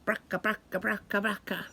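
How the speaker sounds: soft rattling engine noise, bracka bracka; old car rattle. Retold or imitated. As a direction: chug it like a clunky old car. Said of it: "Old cars sound like a".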